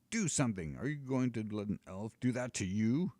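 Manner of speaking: an annoyed giant nagging; scolding giant. Acted. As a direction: snap at the dragon.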